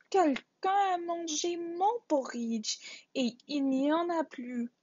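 high little bear voice, mock sobbing; sobbing Baby Bear. A narrator's reading. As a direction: whimper it like a tiny bear. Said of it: "sanglota Bébé Ours". (Bébé Ours sanglote d'une petite voix aiguë)

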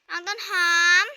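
loud call, name pulled up at the end; calling out again. Acted. Big sister calls little sister a second time, loud call.

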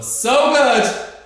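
high, loud burst of praise; excited praise. Real, not acted. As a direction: cheer them on big. Coach praising loudly.

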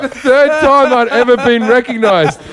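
high, laughing through the words. Real, not acted. Comic admitting he was thrilled at being recognized, high laughing delivery. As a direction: talk through real laughter. Laughing at his own story.

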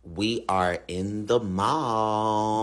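deep, very slow alien drone; deep slow robotic alien. Acted.

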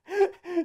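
a bright giggle at herself; short high laugh. Real, not acted. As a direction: laugh off the little demo. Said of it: "c'était pas une grande performance".

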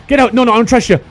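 ordinary delivery

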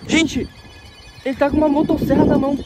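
terrified, fleeing a chainsaw man; being chased. Real, not acted. Ele tá vindo atrás de mim.

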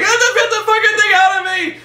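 very high, loud mock shriek; hammed-up screaming. Pregnant scientist begging the robot, hammed-up screaming. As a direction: shriek it in exaggerated panic. Retold woman with alien inside.

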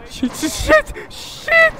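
high, squeaky cursing; panicked. Real, not acted. Player panicking mid-escape, high-pitched alarm. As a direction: squeal the word over and over, rising.

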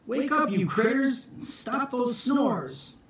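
high wake-up call, slowing at the end. Acted. Jack waking the barn animals, high call.

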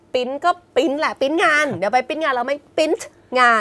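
print said as "pin", dropping R and T; Thai-style, no final T. Shown as a demonstration. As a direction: Thai everyday print งาน as "pin ngan". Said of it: "คือเราไม่มีตัวสะกด".

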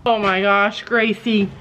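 normal talking voice

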